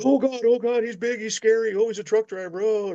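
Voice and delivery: high, hammed-up panic about the big trucker; mock frightened passer-by. Trucker mocks people scared of him at night, high mock panic. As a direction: squeal it like a scared pedestrian.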